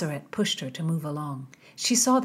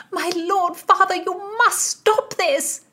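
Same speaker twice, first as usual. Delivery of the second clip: high, raised pleading voice; 13 semitones higher, 5 dB louder than usual. A narrator's reading. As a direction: beg him, drawing out the end.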